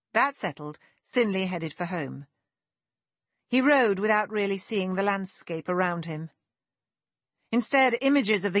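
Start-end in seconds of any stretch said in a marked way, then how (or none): none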